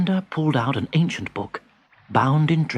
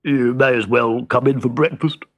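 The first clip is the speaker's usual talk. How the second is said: grumbling. Acted. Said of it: "he grumbled".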